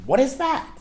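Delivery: high, startled question; squeaky bewildered question; baffled "what is that". Retold or imitated. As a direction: gawk at the bush in confusion. Startled at burning bush.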